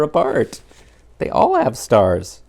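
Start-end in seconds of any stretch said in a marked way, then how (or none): none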